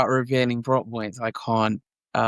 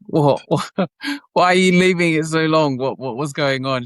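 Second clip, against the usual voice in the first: disbelief; 5 semitones higher than usual. Retold or imitated. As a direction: replay the stunned impatience with the agent. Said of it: "And I was like".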